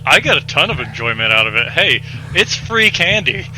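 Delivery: usual speaking voice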